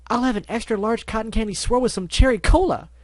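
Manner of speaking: high, loud bubbly order; cheerful. Acted. Pinky ordering at the treat stand, cheering. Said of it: "Pinky cheered".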